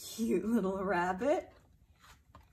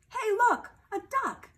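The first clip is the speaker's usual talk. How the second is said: high, bright call of a duck spotted; excited discovery; 9 semitones higher, 7 dB louder than usual. Acted. Parent reading aloud, voicing the duck-seer, excited. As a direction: point it out with delight. Two voices arguing duck or rabbit.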